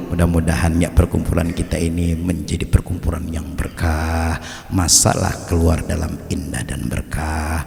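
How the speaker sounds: low, soft, whispered blessing; preacher softly praying that the gathering be blessed; hushed prayer. Acted. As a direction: pray quietly over the gathering.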